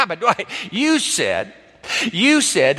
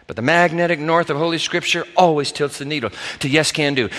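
ordinary talk, nothing marked

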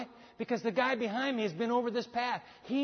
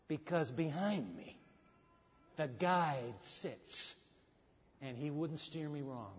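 slow, low, soft confiding; hushed conviction; 5 semitones lower, 8 dB quieter, slower than usual. Real, not acted. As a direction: drop right down and let each phrase land. Hushed answer to his own question.

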